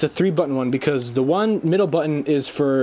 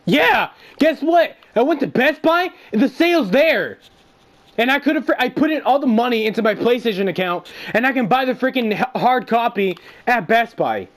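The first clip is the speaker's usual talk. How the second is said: worked-up rant; 5 semitones higher than usual. Real, not acted. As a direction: rant like you can't believe your luck. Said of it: "I was so pissed".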